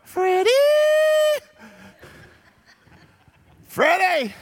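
high, drawn-out calling voice; mom calling from the porch. Retold or imitated. Mom calling her son home at dark, high sing-song call. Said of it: "my mom would go".

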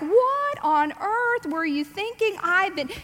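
slow, high exasperated scolding; high drawn-out what on earth; exasperated mother. Retold or imitated. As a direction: drag it out in disbelief. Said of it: "I would have, like".

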